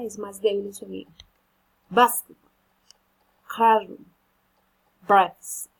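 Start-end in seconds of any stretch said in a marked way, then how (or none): 1.9-5.7 "Basketball", "classroom", "breakfast" said louder, first syllable hit; compound stress drill. Shown as a demonstration. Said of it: "The compound word will carry ... the accentuation".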